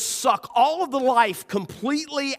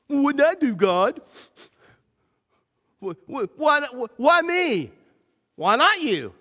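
stammering, whining why me; a grumbler questioning God, higher; mock whine at God; whiny complainer; 4 semitones higher than usual. Voicing a complaining believer and God.

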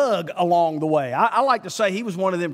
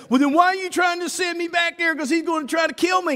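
much higher, then rushing into a shout; a panicked complainer pleading his case; frightened objector talking back to God; whiny protest; 11 semitones higher, faster than usual. Retold or imitated.

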